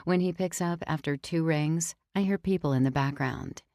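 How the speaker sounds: ordinary delivery, nothing marked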